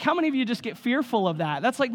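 normal talking voice

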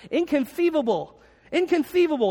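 Vizzini lisp. Retold or imitated. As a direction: squeal it out with a lisp. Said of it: "it comes out like this".

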